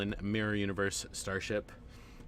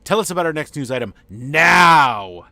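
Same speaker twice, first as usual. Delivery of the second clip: much louder, higher, a royal order; loud imperious command; 7 semitones higher, 18 dB louder than usual. Shown as a demonstration. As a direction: bark it like an impatient king. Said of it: "Sorry, let me rephrase that".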